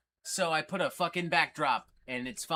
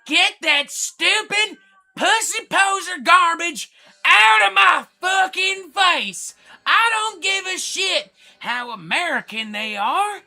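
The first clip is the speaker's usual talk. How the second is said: screaming metalhead, far higher and louder, slow on the big words; Thrashin' Jackson impersonation; 13 semitones higher, 13 dB louder, slower than usual. Retold or imitated. Streamer impersonates metal fan Thrashin' Jackson for chat, screaming and hammed-up. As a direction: rant like a hammed-up thrash fanatic. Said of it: "I think he would say".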